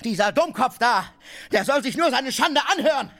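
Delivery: shouting in rage. Acted. Tarantjew yelling insults at Oblomow. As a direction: yell it out for all to hear. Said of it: "Ich will schreien!".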